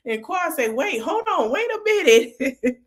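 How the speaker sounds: high, squealing through real laughter; laughing while talking. Real, not acted. Recapping Quad's reaction to the thrown scarf, cracking up. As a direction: crack up as you tell it.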